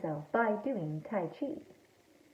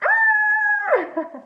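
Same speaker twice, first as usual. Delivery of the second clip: long, very high mock scream; 3 dB louder than usual. A narrator's reading. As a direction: shriek in playful shock. Surprise discovery in story.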